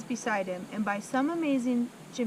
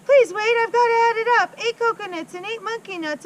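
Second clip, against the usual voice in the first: put-on much higher, loud, frantic child voice; panicked boy; 11 semitones higher, 10 dB louder than usual. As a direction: beg and count in a frightened rush. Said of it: "he cried".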